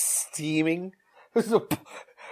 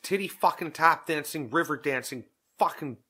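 normal talking voice

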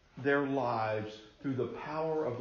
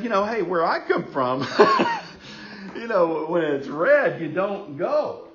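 laughing protest; 5 semitones higher, 8 dB louder than usual. Retold or imitated. Protests to guide about red light.

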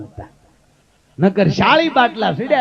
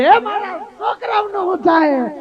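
wailing woman; 9 semitones higher than usual. Retold or imitated.

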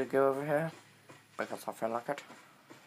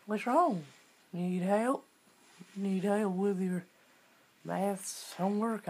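mock sweet voice; 7 semitones higher than usual. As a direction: coo it like talking to a pet. Mock caring voice to pig.

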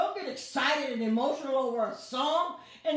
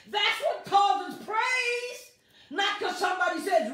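higher, louder preaching lines; fervent preaching; 7 semitones higher, 4 dB louder than usual. Real, not acted. Preacher exhorting viewers through the iPad, fervently. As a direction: build the fire in them.

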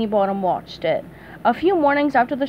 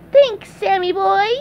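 SpongeBob voice; 9 semitones higher, 5 dB louder than usual. Acted. SpongeBob replies.

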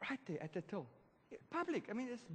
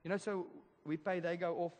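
ordinary talk

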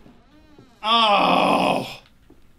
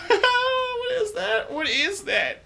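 high, slow, laughing in fright; scared laugh; much slower than usual. Real, not acted. Gamer spooked, asking through a frightened laugh. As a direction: laugh and whimper it out.

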